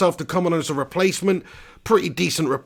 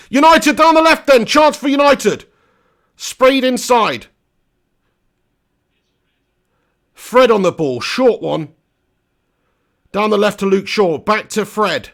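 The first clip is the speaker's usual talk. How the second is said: higher, louder match commentary, brisk calls; live play-by-play; 5 semitones higher, 6 dB louder than usual. Acted. Streamer calling the United attack, live commentary. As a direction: call the action as it happens.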